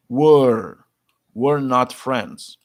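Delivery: "Were" stretched, loud and slow; slow teacher model; slow drill model. Shown as a demonstration. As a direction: model "were not friends" slowly for repeating.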